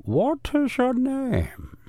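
high, very slow spy voice; drawn-out high villain question; high spy interrogator. Pretend. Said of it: "I'm supposed to have all the voices".

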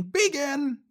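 high-pitched mock commander's yell; retold yell. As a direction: yell the order, imitating a drill commander. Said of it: "he's yelling".

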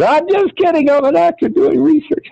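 high-pitched hasty excuse; comic high-voiced alarm; panicked backpedal. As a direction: squeak out of it fast. Chris nervously backs out, pitch leaps.

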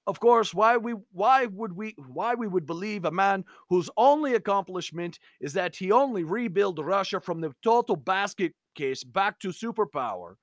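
put-on reading a friend's email in a light Slavic accent; lightly voiced Slavic-accented reading, mock-solemn; a hint of a Slavic accent, reading aloud. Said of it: "I'm going to read this in generally a Slavic accent".

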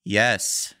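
curt, put-out yes; a hint of a rude drive-thru worker. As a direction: snap a rude one-word answer. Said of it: "super rudely".

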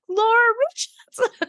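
squealing the name high with glee; gleeful squeal. Retold or imitated. Fan gushing to host, squealing the name. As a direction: fangirl squeal of delight. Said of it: "the glee with which I was like".

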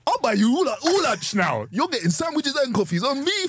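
high-pitched, drawn-out mock boast; playful over-the-top big-spender voice; hammed-up generosity. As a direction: announce it big and high, stretching the words. Acted big spender, laughing.

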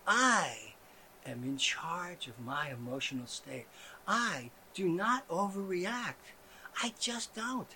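mock zen calm; hushed serene. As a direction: murmur it like a meditation. Whispery serene claims.

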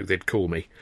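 plain reading voice

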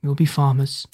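low-key, resigned sadness; mildly downcast surrender; said sadly. A narrator's reading. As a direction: accept it with a touch of sorrow. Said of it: "he said sadly".

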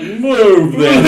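loud, high "Move them" in a heavy put-on Russian accent; mock Russian accent. Imitating the Russian woman in the crowd, loud accent. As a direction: give it again as the Russian lady. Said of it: "She was like".

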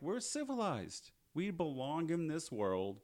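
high, slow, hammed-up plea; mock lofty vampires. Vampires pleading their case to Joe, mock earnest. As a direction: draw it out as a self-important group.

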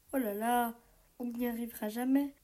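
ordinary delivery

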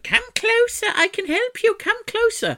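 put-on high, creepy old witch. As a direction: lure her in like a crone. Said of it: "the woman's like".

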